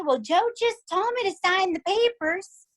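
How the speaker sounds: imitating the mom. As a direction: play the old mom innocently explaining. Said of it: "the mom would say".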